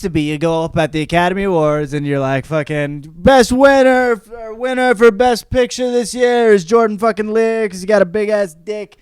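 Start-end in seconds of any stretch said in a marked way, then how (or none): 3.1-9.0 higher, announcer-style voice; a lightly voiced award presenter; mock show host reading the winner.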